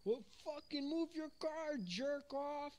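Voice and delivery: high mock shout at a driver; yelling at a driver from mom's car; road rage yell. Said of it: "and I'm like".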